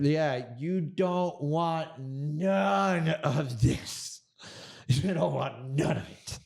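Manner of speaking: slow, drawn-out taunt with laughs breaking in; mock the guy as he backs off, slowly. Retelling the road rage standoff to the host, slow laughing taunt. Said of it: "and I'm just like".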